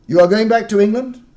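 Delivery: loud, high eager question. Acted. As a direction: lean in, full of hope. Host asks eagerly.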